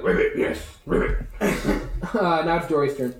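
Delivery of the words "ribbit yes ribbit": croaky ribbiting frog voice; frog creature. Acted. Game master as the healed bullywug ready to fight, croaking. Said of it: "he's like".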